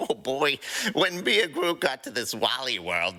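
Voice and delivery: usual speaking voice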